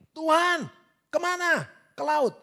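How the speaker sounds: high, loud short cries; acted shouted exchange. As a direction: shout question and answer as Moses and God. Replays Moses's shouted exchange with God.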